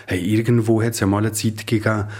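usual speaking voice

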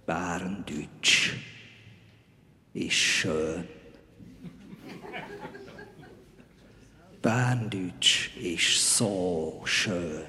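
low, slow, solemn proclamation; deep ceremonial heavenly messenger; Archangel Gabriel. Acted. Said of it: "Rolle als Erzengel Gabriel".

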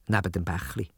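ordinary delivery

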